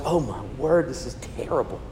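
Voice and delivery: low, hushed retold dismay; appalled reaction. Preacher recalling his reaction to the story, hushed dismay. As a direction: drop away softly, as if aghast at the reading. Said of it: "I was just like".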